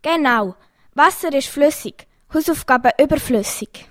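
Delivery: ordinary talk, nothing marked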